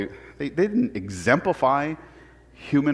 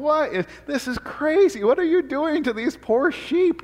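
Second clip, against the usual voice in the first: much higher, horrified disbelief at the sheep; freaking out; 13 semitones higher than usual. Retold or imitated. City boy horrified watching sheep tails cut, high alarmed cry. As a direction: squeal it like a shocked city boy. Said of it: "And I'm like, going".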